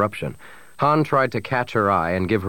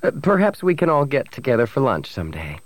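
high, lilting social politeness; breezy, polite send-off; pleasant; 5 semitones higher than usual. A narrator's reading. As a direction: keep it light and gracious, pitched up. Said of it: "Bria continued pleasantly".

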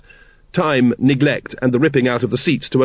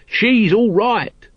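much higher, drawn-out character voice; slow high-pitched defence; Nobby's voice; 10 semitones higher, much slower than usual. A narrator's reading. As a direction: stand up for her, stretching each word. Nobby defends Lady Ramkin.

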